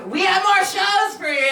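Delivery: high, loud call to the audience; hype shout. Real, not acted. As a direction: sell the next acts to the room. Host hypes crowd.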